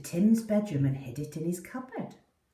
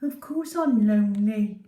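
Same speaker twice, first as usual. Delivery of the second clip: a touch louder, indignant little dog; a lightly voiced fed-up pet; grumbling dog voice; 3 semitones higher, 6 dB louder than usual. Poppy answering Tim in her head, mildly indignant.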